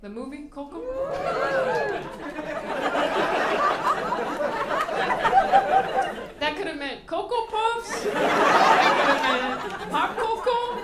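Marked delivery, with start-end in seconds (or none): 0.9-6.3 big high burst of laughter; loud laugh. Real, not acted. Big laugh at own joke. 6.4-8.0 said through laughter, louder and higher; laughing. Real, not acted. Joking about the Coco mixup. 8.0-10.8 slow, much louder, laughing through it. Real, not acted. Joking about the Coco mixup.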